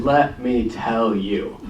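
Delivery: slow, deliberate lead-in; measured retelling. Retold or imitated. Reed answering his old schoolmates, slow and deliberate. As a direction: draw out each word unhurriedly. Reed's answer, retold before accent version.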